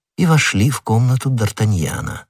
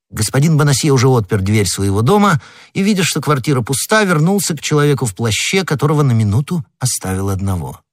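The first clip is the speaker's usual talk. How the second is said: ordinary delivery, nothing marked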